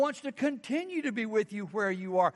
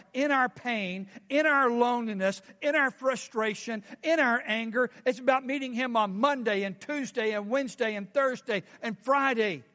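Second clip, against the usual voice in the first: ordinary talk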